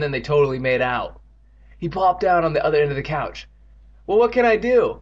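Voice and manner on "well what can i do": usual speaking voice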